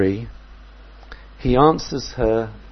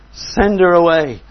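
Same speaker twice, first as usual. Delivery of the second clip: insistent demand; 5 semitones higher, 8 dB louder than usual. Retold or imitated. Repeat of disciples' demand.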